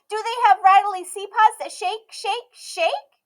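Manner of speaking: put-on high, bright little girl voice, loud and proud; a stubborn little girl declaring herself; Emmy's child voice. A narrator's reading. Emmy asking.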